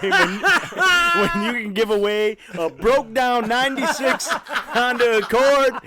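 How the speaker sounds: high, laughing through the words; can't stop laughing while talking. Real, not acted.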